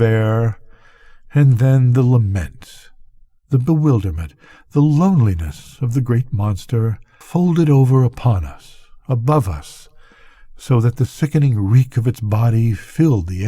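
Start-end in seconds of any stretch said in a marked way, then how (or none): none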